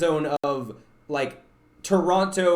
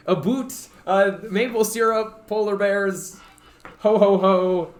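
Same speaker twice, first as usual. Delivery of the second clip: mock Canadian accent; 3 semitones higher, 4 dB louder, slower than usual. Streamer teasing a viewer who expected it, mock Canadian accent. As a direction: put on a joking Canadian accent. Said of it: "do you expect me to talk like, what's a Canadian accent?".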